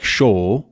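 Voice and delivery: SHORE as "shaw"; British-like, no R. Shown as a demonstration. Teacher showing lack of R in shore, British-like. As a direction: round the vowel of SHORE and drop the R. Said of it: "sounds a lot more British".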